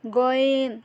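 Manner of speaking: গয়েন from the end of the throat, without pressure; Arabic letter demo. Shown as a demonstration. Teacher showing students how to say গয়েন. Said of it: "গয়েন সাধারণভাবে হলকের শেষ ভাগ হতে পড়তে হবে".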